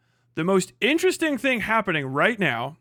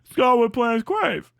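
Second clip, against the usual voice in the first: slow, higher dopey voice; Idiocracy quote; 5 semitones higher than usual. Retold or imitated. Quoting Idiocracy to the co-host in a slow, higher dopey voice. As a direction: drawl the line like a dim movie character. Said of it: "people that did characters in Idiocracy".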